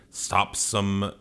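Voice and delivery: ordinary delivery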